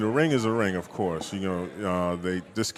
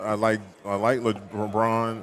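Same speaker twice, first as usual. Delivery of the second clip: ordinary delivery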